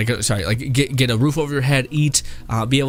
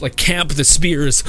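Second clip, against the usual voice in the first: normal talking voice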